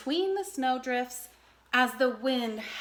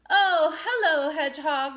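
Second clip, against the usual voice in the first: otter voice; 2 semitones higher, 7 dB louder than usual. A narrator's reading. Otter replies.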